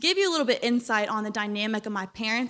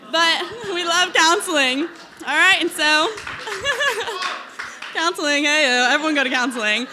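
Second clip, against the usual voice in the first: higher, louder, giggling through the words; laughing; 5 semitones higher, 5 dB louder than usual. Real, not acted. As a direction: laugh it out, embarrassed.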